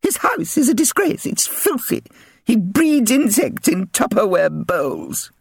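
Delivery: very high shriek; shrieking chairwoman. Acted. Chairwoman mother addressing the residents' committee, shrieking. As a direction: shriek it from the stage. Missus Pye ranting.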